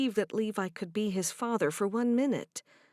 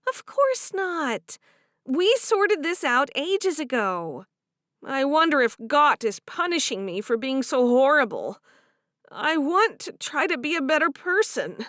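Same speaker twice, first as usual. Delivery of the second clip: higher and louder, complaining; frustrated young wife; 6 semitones higher, 6 dB louder than usual. Acted. Favor complaining about Harriet, higher and aggrieved. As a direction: vent about the mother-in-law. Voiced character dialogue.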